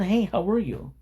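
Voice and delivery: mock friendly greeting. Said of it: "And it's not".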